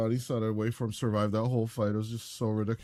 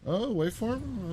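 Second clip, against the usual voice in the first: surprised; 11 semitones higher, slower than usual. Real, not acted. Surprised at a play.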